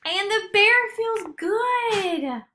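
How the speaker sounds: high, loud, slow joyful line; joyful announcement. A narrator's reading. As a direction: burst out with relief. Happy turn in story.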